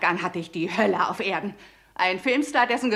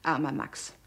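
soft grief; 6 semitones lower, 6 dB quieter than usual. Acted. Mourning dead friend.

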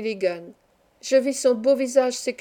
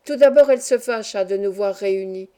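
ordinary delivery, nothing marked

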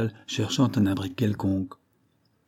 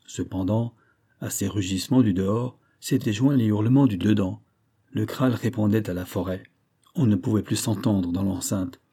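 plain reading voice

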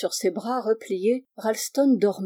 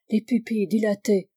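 plain reading voice

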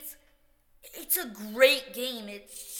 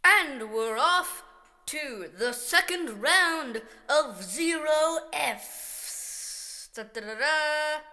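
announcer intro; 3 semitones higher, 3 dB louder, slower than usual. Acted. Round intro, announcer flourish.

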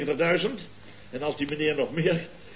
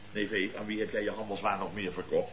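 lowered, hushed mock interrogation; mock stern question; 9 semitones lower, 8 dB quieter than usual. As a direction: interrogate the nephew in a low hushed tone. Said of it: "En dan vraagt de eigenaar".

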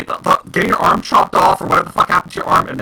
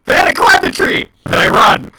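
ordinary delivery

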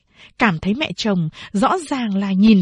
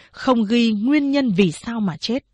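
usual speaking voice

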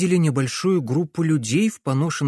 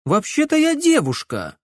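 indignant; 13 semitones higher, 3 dB louder than usual. A narrator's reading. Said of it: "возмутилась Камилла".

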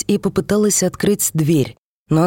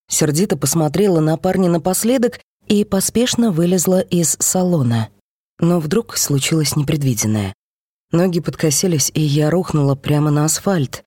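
ordinary delivery

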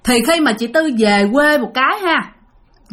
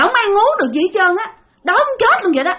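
much higher, strange voice of the hungry ghost; possessed changed voice; 8 semitones higher than usual. Retold or imitated. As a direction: spirit complaining of starving, pitched way up. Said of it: "không còn cái giọng của chị Tư nữa".

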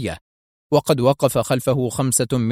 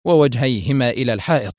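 plain reading voice

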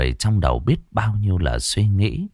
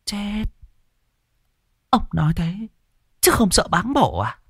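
put-on higher, gentle old woman's voice; calm old mother; 13 semitones higher than usual. A narrator's reading. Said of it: "Bà Bắc ôn tồn". (bà vợ ôn tồn nhắc chồng, giọng cao nhẹ nhàng)

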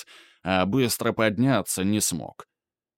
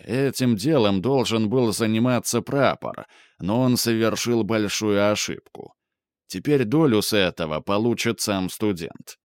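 ordinary delivery

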